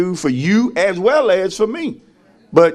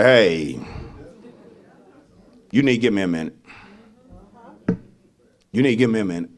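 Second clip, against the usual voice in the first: lower, softer request for a minute; mock plea for space; 11 semitones lower, 6 dB quieter, faster than usual. Hurt friend telling the speaker to give them a minute, low and soft. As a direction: ask for room, low and quiet. Voices someone asking for space.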